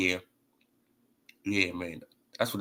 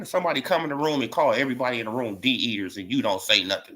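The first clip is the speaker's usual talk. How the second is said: ordinary talk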